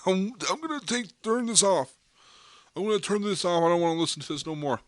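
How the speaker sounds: high, put-on whiny voice turning the podcast off; mock a listener who wants to tune out. Podcast host mocking a listener who refuses to hear hard truths, high whiny mockery. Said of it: "you wanna be like".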